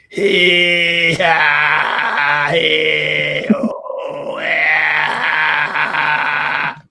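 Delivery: loud, drawn-out surfer holler; surf guy. Pretend. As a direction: holler long vowels like a surf dude. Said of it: "this guy used to be more of a surf guy".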